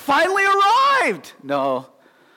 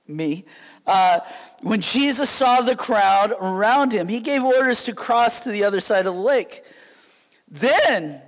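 usual speaking voice

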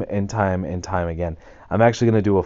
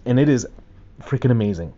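ordinary talk

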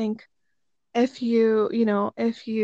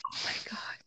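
breathless whispered oh my God; awed whisper; 14 dB quieter than usual. Real, not acted. Host whispering in awe at her guest's word, whispered. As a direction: whisper it in real amazement.

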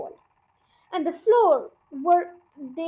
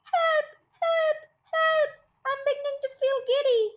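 very high panicked squeal; high-pitched distressed pleading; acted cries for help; 10 semitones higher than usual. A narrator's reading. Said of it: "screamed Missus Twit".

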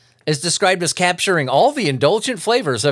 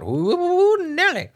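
high, drawn-out mock alarm; ooh Nelly exclamation; much slower than usual. Real, not acted. Vlogger warning viewers about the result, high mock alarm. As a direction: squeal it like a grossed-out warning.

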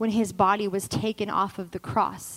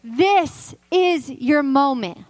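raised, driving pulpit challenge; full-force preaching; 3 semitones higher, 4 dB louder than usual. Acted. Preacher challenging the ladies to cross over, forceful and urgent. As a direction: press the challenge on the congregation.

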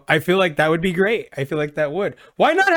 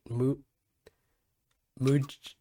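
ordinary delivery

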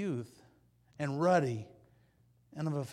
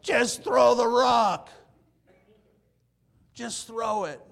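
high, loud, slow yell of "just throw the rock"; impatient army shout; 8 semitones higher, 13 dB louder, slower than usual. Retold or imitated. Israelite army yelling at David to throw, loud. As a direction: shout at him to throw it already. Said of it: "the Israelis' army is like".

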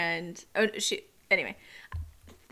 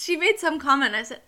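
usual speaking voice

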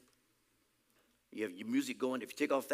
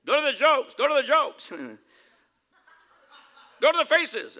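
loud, high mock command; hammed-up high, loud prompting; put-on shouted instruction; 11 semitones higher, 11 dB louder than usual. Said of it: "This time talk like this, right?".

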